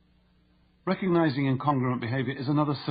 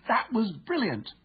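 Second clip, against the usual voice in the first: "That was brilliant" pitched much higher and slow with delight; excited; 9 semitones higher, slower than usual. Shown as a demonstration. Teammate praising a great goal, thrilled. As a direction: cheer a fantastic goal. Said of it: "heard to say with excitement".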